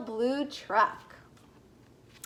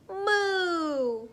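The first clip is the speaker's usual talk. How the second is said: long drawn-out moo; cow moo; 4 semitones higher than usual. A narrator's reading. Narrator voicing the cow, long raised moo. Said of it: "Cow said".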